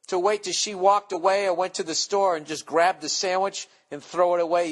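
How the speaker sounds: usual speaking voice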